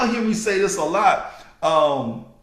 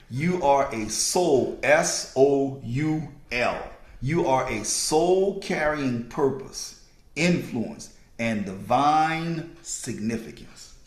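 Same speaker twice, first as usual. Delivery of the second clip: low, deliberate read with the key word spelled out; slow dictation; 5 semitones lower, slower than usual. Real, not acted. Speaker repeating a sentence for listeners writing it down, slowly. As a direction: dictate it slowly, letter by letter on soul. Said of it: "I'm gonna say it slow".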